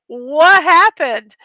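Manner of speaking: slow, loud drawn-out what happened; mechanic's astonished question. Retold or imitated. Mechanic asking mom about the wrecked car, astonished. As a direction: let it hang in disbelief. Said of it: "he goes".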